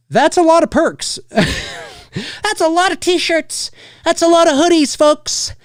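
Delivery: squeaky, high-pitched disbelief; high incredulous joking. Pretend. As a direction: pitch way up, amused exaggeration. Joking about crowdfunding perks.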